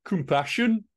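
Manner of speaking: a bad Birmingham impression of Compassion?; Compassion? in a mock Brummie accent, rising tune; terrible Brummie accent. Shown as a demonstration. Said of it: "my terrible Brummie accent".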